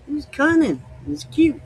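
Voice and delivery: lightly voiced Mainer praising; Mainer quote. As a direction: gush like a Maine neighbor about a cute kid. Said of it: "but here it means".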